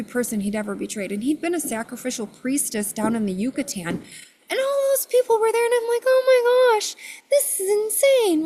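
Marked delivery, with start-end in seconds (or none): 4.5-8.4 very high, louder reenacted amazement; overwhelmed astonishment. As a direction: exclaim it high, as if swamped by the crowd. Overwhelmed by the crowd in story.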